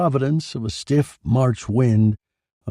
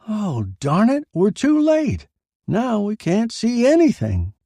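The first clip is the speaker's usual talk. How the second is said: excited reporter; 13 semitones higher, 4 dB louder than usual. Acted. Reporters miss the shot.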